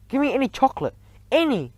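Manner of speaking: high pitched pleading demand; whiny demand. A narrator's reading. Bruno begging the witch, high whiny demand. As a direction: grab for any candy.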